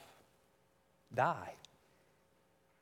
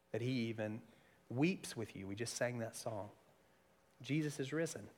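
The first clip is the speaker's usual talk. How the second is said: softer, lower, gentle pastoral voice; tender; 4 semitones lower, 7 dB quieter than usual. Real, not acted. Pastor comforting grieving listeners, soft and tender. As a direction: speak softly to those who are hurting. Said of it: "tender appeal to the hurting".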